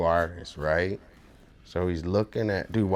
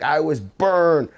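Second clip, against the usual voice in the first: slow, higher drawn-out claim; hammed-up unhinged stranger insisting; retelling the customer's claim, slow and high; mock deranged complaint; 7 semitones higher, 4 dB louder, slower than usual. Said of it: "he was like".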